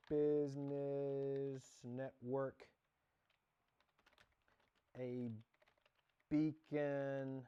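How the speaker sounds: very slow, grand announcer-style read; put-on formal voice. Introducing her business network in a mock grand announcer voice. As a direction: stretch each word out like an official pitch. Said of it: "I don't know why I'm talking like that".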